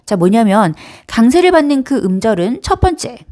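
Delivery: ordinary talk, nothing marked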